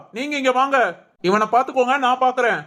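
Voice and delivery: usual speaking voice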